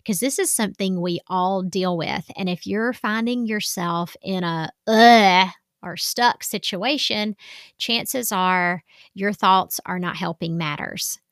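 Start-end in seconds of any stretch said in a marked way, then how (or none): 4.9-5.5 loud groaning ugh of being fed up; an exasperated loud ugh; frustrated ugh. Retold or imitated. Describing feeling stuck.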